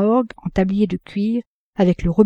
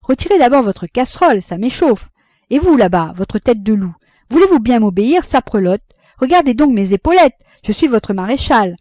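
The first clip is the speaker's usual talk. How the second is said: mock commander; 4 semitones higher, 5 dB louder than usual. A narrator's reading. Rosanette commanding the squabblers.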